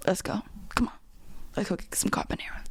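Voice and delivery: hushed, quick flirt whisper; whispered invitation. Pretend. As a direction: lean in and whisper it. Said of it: "Here's my impression".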